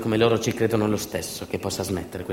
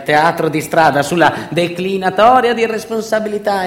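1 mocking; 10 semitones higher, 9 dB louder than usual. Mocking trial jargon, voice rising.

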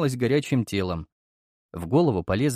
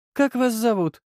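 с улыбкой; 11 semitones higher, 3 dB louder than usual. Acted. Аристократка с улыбкой спрашивает.

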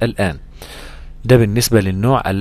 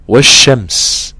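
slow chanted recitation, louder; Quran recitation demo; 6 dB louder, much slower than usual. Shown as a demonstration. Teacher reciting an example word, slow and chanted.

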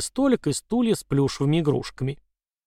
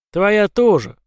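defiant shout; 6 semitones higher, 6 dB louder, slower than usual. A narrator's reading. Jerry defiantly calls out.

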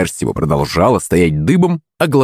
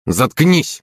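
curt, higher-pitched rebuke; snarled; 6 semitones higher than usual. A narrator's reading. Red-faced captain to complaining teammate, understated snarl. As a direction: cut them off with mild anger. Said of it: "рыкнул на него покрасневший капитан".

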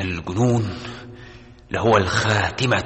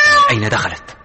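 startled; 4 dB louder than usual. Acted. Alarmed search for the cat.

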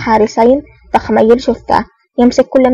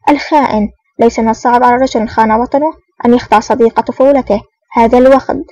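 ordinary delivery, nothing marked

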